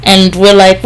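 ordinary delivery

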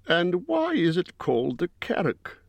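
a small eager voice pitched high; Bilbo asking. A narrator's reading. Hobbit asking the wizard on the march, high and curious. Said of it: "asked Bilbo".

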